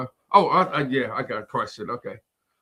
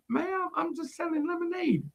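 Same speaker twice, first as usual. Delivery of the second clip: high and soft, meek; a timid kid repeating herself; lightly voiced quiet girl; little girl voice; 12 semitones higher, 9 dB quieter than usual. Girl answers again softly.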